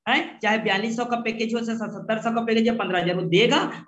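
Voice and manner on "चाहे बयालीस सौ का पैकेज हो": ordinary talk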